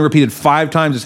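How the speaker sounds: ordinary delivery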